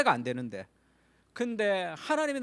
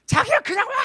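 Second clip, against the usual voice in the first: high loud cry; wife crying out in panic; retold yell; 10 dB louder than usual. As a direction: yell it out in alarm. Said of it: "막 그런다고".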